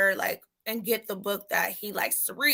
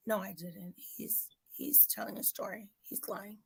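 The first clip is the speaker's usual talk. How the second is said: retold whispered denial; 2 semitones lower, 17 dB quieter than usual. Teacher denying son's claim.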